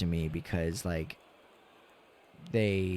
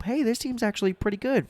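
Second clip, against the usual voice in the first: mock hopeful fan; 14 semitones higher, 4 dB louder than usual. Voicing naive hopeful fans.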